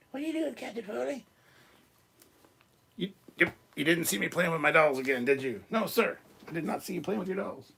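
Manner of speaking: the doll caught playing with dolls, high and squeaky. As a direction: squeak it like a toy figure. High voiced doll dialogue.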